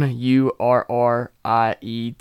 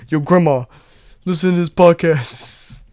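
loud, high call to grandma; 7 semitones higher, 10 dB louder than usual. Pretend. Yelling to grandma to listen to the podcast. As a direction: holler it across the room, high.

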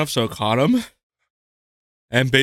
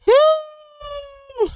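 excited whoop. Real, not acted. Cheer after hot shot.

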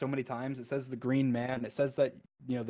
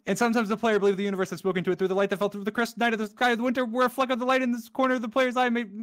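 fast, higher, breathless poem reading; rushed reading; 9 semitones higher, 7 dB louder, faster than usual. Real, not acted. Gamer racing the End Poem credits, hurried frantic reading. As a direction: cram the words before they vanish.

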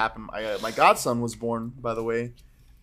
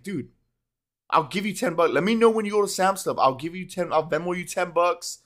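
higher, louder, keen offer to pay; eager plea; 6 semitones higher, 4 dB louder than usual. Retold or imitated. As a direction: push the friend hard to share the deal. His excited offer to friend.

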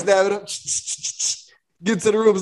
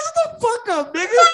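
very high-pitched mock outrage; retold disbelieving retort; 12 semitones higher than usual. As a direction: squeal it in disbelief.

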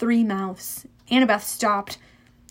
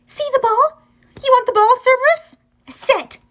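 high, loud, excited shouting to a dog; shouted dog command; 13 semitones higher, 6 dB louder than usual. A narrator's reading. Annabeth shows Cerberus the ball, shouting commands. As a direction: shout high and loud, ending on a firm order. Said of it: "She shouted".

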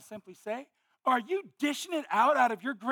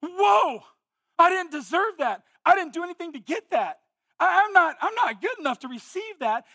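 amazed wake-up cry; 7 semitones higher, 8 dB louder than usual. Pretend. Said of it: "wake up in the morning and go".

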